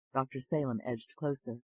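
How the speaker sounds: ordinary delivery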